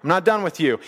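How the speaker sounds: preacher mimicking a harsh accuser to a sinful woman; mock harsh calling out. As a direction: bark it like a scornful accuser. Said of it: "He didn't talk to her like that".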